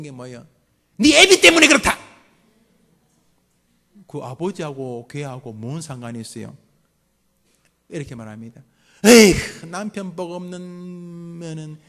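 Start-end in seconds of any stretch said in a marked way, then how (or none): 1.0-2.0 high, much louder blaming yell; furious mother shout. Acted. Angry mother blaming father. 9.0-11.8 slow, much louder disgusted wail; bitter lament. Acted. Mother lamenting her husband.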